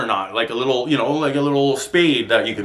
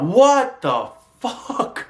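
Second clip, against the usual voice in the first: high, drawn-out angry disbelief; slow furious outburst; 9 semitones higher, much slower than usual. Retold or imitated. Retelling the coworker who opened the door, dragged-out furious yell. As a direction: stretch it out in raging disbelief. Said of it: "I went".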